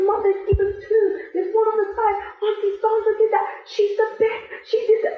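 put-on very high, thrilled little girl's voice; squeaky delighted child voice; excited child. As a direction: imitate a proud six-year-old pointing out the billboard. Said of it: "and she goes".

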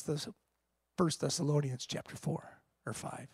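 ordinary talk, nothing marked

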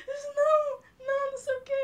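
high, loud mock sob; high, loud mock wail of someone crying at a film; imitating sobbing. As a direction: ham up a wailing moviegoer. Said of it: "começou a chorar copiosamente".

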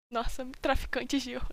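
ordinary talk

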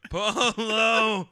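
loud laughing Polo reply; calling out. Pretend. Answering the pool game call, loud and laughing. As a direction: shout Polo back through a laugh.

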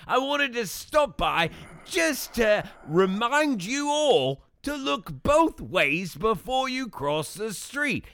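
high, hammed-up Australian accent; shitty Australian accent. Wallaby Wanker greeting podcast viewers in a hammed-up Australian accent. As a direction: play a cheesy Aussie safety mascot. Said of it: "your shitty Australian accent".

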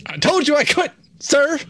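high indignant protest; mock indignant. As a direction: huff like you're storming out. Mock quitting outburst.

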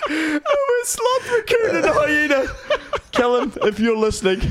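high-pitched laugh-crying through the list. Real, not acted. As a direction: crack up so hard the voice breaks. Cracking up listing animals.

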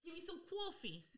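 hammed-up New York accent ordering coffee; fake New York accent. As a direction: play a bad New Yorker at a coffee counter. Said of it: "your awful accent".

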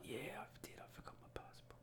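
hushed, barely voiced admission; under the breath; whispered confession. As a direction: whisper it like an embarrassing secret. Confessing the problem at the desk.

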